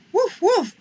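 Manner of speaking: high, loud yappy puppy woofs; puppy bark. Acted. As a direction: bark like a small eager puppy. Puppy barks.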